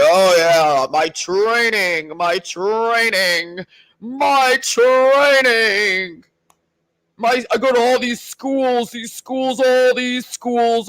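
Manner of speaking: slowed, drawn-out boastful training brag; mock bragging soldier. Mocking Marcus bragging about schools, slow drawn-out boast. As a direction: drone on like a self-important recruit.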